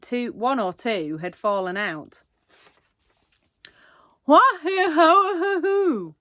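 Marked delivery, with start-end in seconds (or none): 4.2-6.1 high, loud garbled cries without teeth; toothless shout. A narrator's reading.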